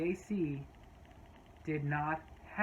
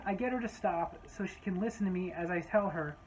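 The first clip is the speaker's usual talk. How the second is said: ordinary talk, nothing marked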